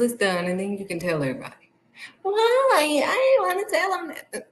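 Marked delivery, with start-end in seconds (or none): 2.2-4.5 very high, whiny mimicry; protesting daughter. Daughter objecting to mother's advice, protesting. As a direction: imitate a child's high-pitched protest.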